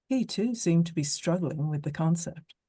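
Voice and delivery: ordinary delivery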